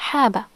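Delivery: "haba" with one quick b, no hold; single b. Shown as a demonstration. Showing the word without shadda, "haba" with single b. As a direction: run "haba" together on a single short b. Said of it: "if it was only fatha".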